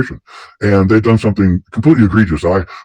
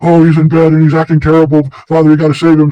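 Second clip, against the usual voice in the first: higher-pitched woman's voice, held up throughout; pleading woman; 7 semitones higher than usual. Retold or imitated. Woman begging the priest to save the possessed boy, high pleading voice. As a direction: plead for help in a raised, put-on voice. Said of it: "the woman comes and goes".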